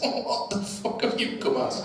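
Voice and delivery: high, incredulous comic quote; mock incredulity. Flintoff to the dressed-up High Sheriff, mock incredulous.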